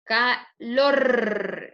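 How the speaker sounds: "Calor" split, LOR loud and drawn out; final-syllable stress. Shown as a demonstration. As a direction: punch and stretch the end of "Calor". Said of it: "la última sílaba".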